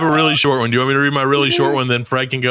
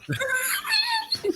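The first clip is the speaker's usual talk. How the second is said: evil laugh; 8 dB quieter than usual. Acted. Villain laughs after monologue.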